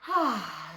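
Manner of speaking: long relieved sigh; contented sigh. A narrator's reading. Dusty Locks flops onto the feather bed, sighing. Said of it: "sighed Dusty Locks".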